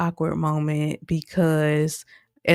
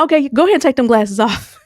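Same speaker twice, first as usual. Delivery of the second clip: higher, louder and fast; blunt demand; 9 semitones higher, 6 dB louder, faster than usual. Retold or imitated. Miss Shalise telling Bravine to remove sunglasses, loud and fast. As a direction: snap the order out, no filter.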